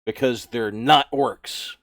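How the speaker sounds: slow, loud declaration; orc zealot. Acted. Orc explaining their war on non-orcs, slow and loud. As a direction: proclaim the orc creed.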